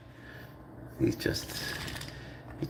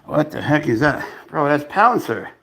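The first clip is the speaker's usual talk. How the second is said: usual speaking voice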